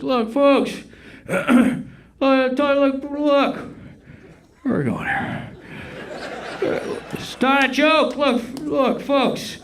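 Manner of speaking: yelling Biden impression. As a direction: start out yelling like the president on stage. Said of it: "Be like".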